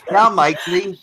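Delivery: a mock British accent with a giggle underneath; bad British accent. Trying the British accent again for friends, still botched. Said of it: "Can you do a British accent?".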